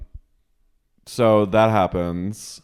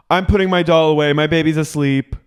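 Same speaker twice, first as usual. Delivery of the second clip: high, loud, quick mock huff; Lisa, bowing out; 7 semitones higher, 5 dB louder than usual. Housewife putting her doll away, high mock-huffy voice. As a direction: shut the game down in a put-on high voice. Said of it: "Lisa goes".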